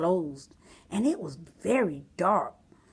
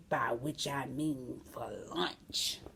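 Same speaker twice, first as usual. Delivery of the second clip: slow, sly whisper; 7 semitones lower, 9 dB quieter, slower than usual. Acted. Wolf whispers his plan to himself, slow and sly. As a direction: whisper it as a wicked secret. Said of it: "And then he whispered".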